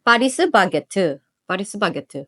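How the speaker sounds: Paris Baguette as Korean "Pa-ri-su Ba-ge-tteu"; Paris Baguette with Korean vowels added; Korean accent. Shown as a demonstration. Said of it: "You gotta say it in a Korean accent".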